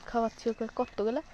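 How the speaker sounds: ordinary talk, nothing marked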